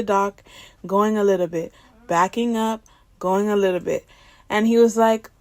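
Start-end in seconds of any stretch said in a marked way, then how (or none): none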